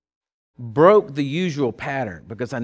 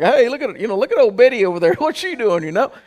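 high, loud, quick sheep voice; gossiping sheep; 7 semitones higher, 6 dB louder than usual. Retold or imitated. Sheep gossiping about one with bucket stuck, high and loud. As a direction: call out high and loud, half laughing. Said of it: "All the other sheep are like".

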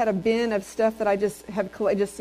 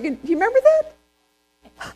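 much higher, slow and louder; amazed question; 12 semitones higher, 6 dB louder, slower than usual. Retold or imitated. Preacher marvelling at her old preaching, slow and much higher. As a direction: ask it with wide-eyed wonder. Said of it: "I was like".

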